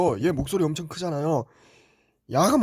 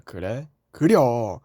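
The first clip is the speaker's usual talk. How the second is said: ordinary delivery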